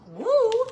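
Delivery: high, loud "woo"; rising, full-voiced cheer; excited whoop. Real, not acted. Whoops mid-story about her diet.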